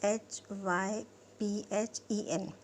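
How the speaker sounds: slow letter-by-letter spelling of hyphen; spelling out slowly. Shown as a demonstration. Teacher spelling hyphen for students, slow and deliberate. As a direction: spell H Y P H E N out one letter at a time, slowly. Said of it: "अब है hyphen".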